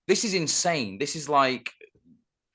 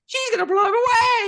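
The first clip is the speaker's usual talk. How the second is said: imitating a shrill cracking yell, much higher and louder; cracking shout; 8 dB louder than usual. Co-host mimicking the character's cracked movie yell. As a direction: screech it like a cartoon. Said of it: "that way his voice goes".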